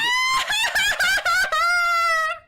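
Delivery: loud, high drawn-out shriek; wordless high yelling, long and loud; Sokka impression. Wordless Sokka impression on request, loud high shriek. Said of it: "what about your Sokka impression".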